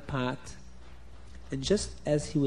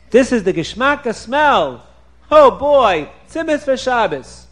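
high, loud joyful exclaim; thrilled; 8 semitones higher, 10 dB louder than usual. Acted. Chaim Yankel thrilled by the tzimis smell, high and loud. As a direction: burst out with greedy joy.

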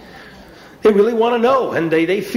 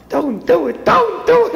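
panicked pleading; 11 semitones higher, slower than usual. Acted. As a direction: beg him to stop, voice cracking up high.

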